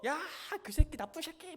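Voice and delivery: high, loud mock outburst to a friend; angry venting. Annoyed person calls a friend over to complain, acted angry venting. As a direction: let rip with hammed-up indignation. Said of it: "화가, 화가 짜증나면 친구 불러다가".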